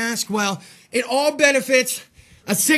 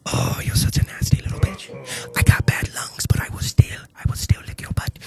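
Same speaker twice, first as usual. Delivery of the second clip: hushed, breathy mock-creepy whisper; 5 semitones lower, 5 dB quieter than usual.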